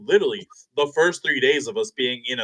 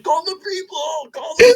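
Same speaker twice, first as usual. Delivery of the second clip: high-pitched imitation of a cry for help; hammed-up alarm, pitched way up; mock panicked plea; 13 semitones higher than usual. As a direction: mimic a punched man calling for security. Imitating Dwayne calling for security.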